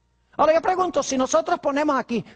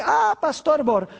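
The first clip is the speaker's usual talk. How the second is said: objecting churchgoer; 4 semitones higher, slower than usual. Retold or imitated. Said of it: "Algunas personas dicen".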